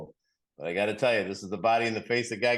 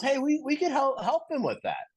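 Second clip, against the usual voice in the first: much higher, eager chicken voice. Retold or imitated. Chicken pitching the food-donation idea to the pig, high and eager.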